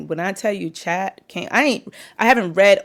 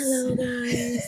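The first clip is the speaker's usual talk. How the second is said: slow, higher mock podcast greeting; mimicking podcast voice; 4 semitones higher, 4 dB quieter, much slower than usual. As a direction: imitate a co-host's drawn-out podcast greeting. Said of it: "Why are you trying to sound so".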